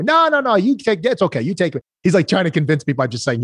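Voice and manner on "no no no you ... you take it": high, sing-song Italian voice for the client; playful high Italian impression; imitating Italian accent. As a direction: mimic the Italian client's broken English, pitched up. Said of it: "he was Italian".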